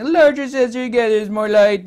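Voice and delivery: high, loud sneering quote; mock the know-it-all YouTuber. Mocking other YouTubers' claim.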